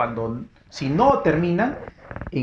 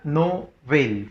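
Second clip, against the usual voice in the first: "Novel" split as no... VEL; syllable-by-syllable stress. Shown as a demonstration. Teacher splitting the word into syllables, Novel stressed on VEL. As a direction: break Novel in two, voice force on VEL. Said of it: "Fuerza de voz en la vel".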